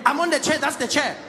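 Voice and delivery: put-on high-pitched, exasperated African-accented man; arguing African passenger. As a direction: argue insistently in a high voice over the chair. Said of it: "he's like".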